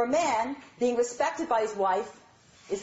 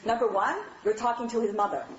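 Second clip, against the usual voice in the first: ordinary delivery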